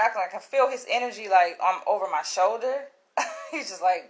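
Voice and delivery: ordinary delivery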